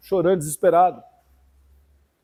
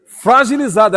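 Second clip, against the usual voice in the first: "Fragilizada" hit louder and higher; "Fragilizada" called out loud and high; emphatic repeat; 6 semitones higher, 6 dB louder, slower than usual. Acted.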